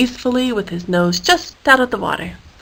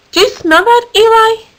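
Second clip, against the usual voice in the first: much higher, sniffing question; high curious voice; 13 semitones higher, 5 dB louder than usual. Acted. David asking his elephant pal about a smell, high voice. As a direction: ask brightly, pitched way up.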